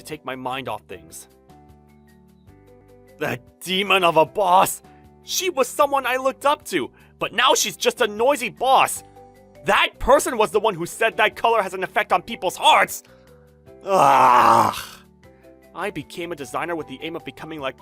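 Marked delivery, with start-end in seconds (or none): 3.2-13.0 loud, high venting; angry drunken rant. Acted. Designer venting alone at a pub about his boss, angry rant. 13.8-14.7 loud, high wailing groan; frustrated groan. Acted. Designer fed up with his boss at the pub, frustrated groan.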